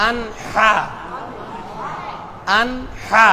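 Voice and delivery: আনহা with a clearly sounded n, no hum; clear nun. Shown as a demonstration. Teacher models for the class, clear n in আনহা. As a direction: pronounce the n in আনহা distinctly before the h. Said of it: "স্পষ্ট হবে".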